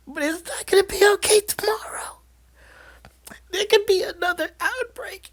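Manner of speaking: tiny, very high whispery mock-sappy voice; sappy aunt. Aunt warning her niece of another outbreak, mock sappy. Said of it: "this whole sappy thing where she's like".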